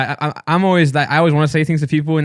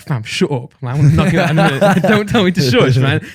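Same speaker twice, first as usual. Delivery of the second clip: retold threat, a bit louder and faster; mock threat; 2 semitones higher, 3 dB louder than usual. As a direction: play at threatening the shusher.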